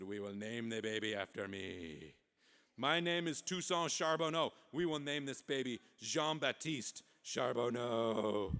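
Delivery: hammed-up French accent; pompous Frenchman with a thick accent. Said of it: "that French guy jumped out".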